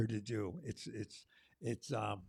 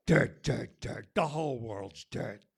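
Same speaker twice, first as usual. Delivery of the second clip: spoken word poem; 3 semitones higher, 8 dB louder, slower than usual. Acted. Poet reading a spring poem to the open mic crowd, declaiming loudly. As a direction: perform the poem like calling the earth awake.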